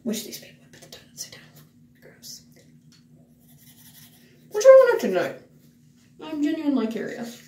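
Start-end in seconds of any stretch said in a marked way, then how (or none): 0.0-2.4 whispering. Real, not acted. Muttering to herself in bathroom.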